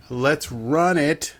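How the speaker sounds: slow, drawn-out announcer call; segment launch. Pretend. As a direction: stretch each word out to kick it off. Ceremonially launching the recap segment.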